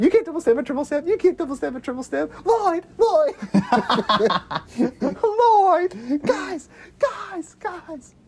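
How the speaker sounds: high, fast, excited movie quote; Dumb and Dumber quote. Retold or imitated. Fan quoting Dumb and Dumber to a cohost, high giddy voice. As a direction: rattle it off pitched high and giddy. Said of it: "reminds me of Dumb and Dumber".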